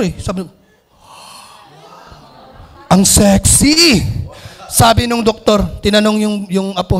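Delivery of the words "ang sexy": slow, drawn-out admiring shout; stretched-out lustful shout; leering exclamation. Acted. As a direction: drool over the word like a lecherous doctor. Doctor leering at pretty granddaughter.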